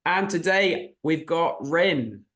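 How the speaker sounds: ordinary talk, nothing marked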